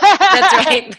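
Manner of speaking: high, loud laugh through the words. Real, not acted. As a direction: laugh it out in agreement. Laughing agreement.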